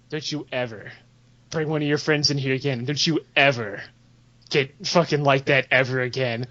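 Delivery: low quiet threat. Retold or imitated. Old dwarf warns the player never to return, low and hushed. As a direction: menace him in a hushed low growl.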